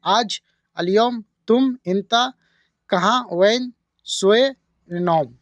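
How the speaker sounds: slow, each Hindi word paired with its Arabic; patient slow teacher breakdown; slow word-by-word gloss. Shown as a demonstration. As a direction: lay the two languages side by side slowly. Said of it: "सोना को अरबी में नौम बोलते हैं".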